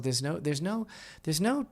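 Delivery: ordinary talk, nothing marked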